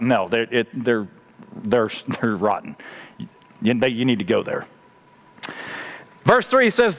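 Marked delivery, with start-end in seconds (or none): 0.0-4.7 much lower, hushed voice of God; God answering firmly. Acted. God's firm answer to pleading Jonah.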